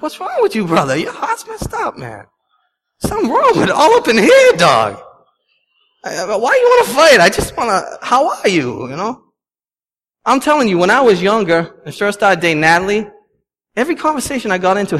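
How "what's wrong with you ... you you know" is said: high, exaggerated street voice; mock scolding a friend. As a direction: play it up as a mock-scolding buddy. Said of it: "I'm like".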